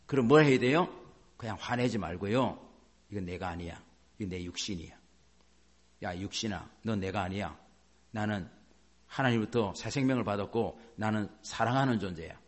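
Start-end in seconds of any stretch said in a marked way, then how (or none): 3.1-12.4 low, soft, steady self-talk; quiet self-declaration. Shown as a demonstration. Believer telling own flesh "not me" instead of anger, quiet and low. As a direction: calmly talk yourself down, well under full voice.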